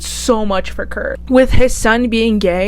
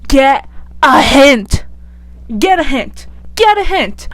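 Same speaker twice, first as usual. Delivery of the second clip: drawn-out first, then higher and quicker; mock exasperation; 3 semitones higher, slower than usual. Vlogger tells off Emma for not taking the hint, mock exasperation. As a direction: hammer it home with growing exasperation. Scolding characters who ignore rejection.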